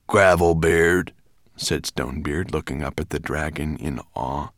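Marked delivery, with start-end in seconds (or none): 0.1-1.1 slow, loud dwarf voice; gruff dwarf. A narrator's reading. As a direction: answer heavily as an old mountain dwarf. Stonebeard answers as gruff dwarf.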